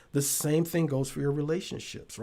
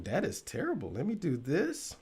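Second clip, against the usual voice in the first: ordinary talk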